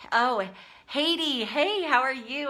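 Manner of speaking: light up greeting a friend; excited greeting. Real, not acted. Greeting a viewer live.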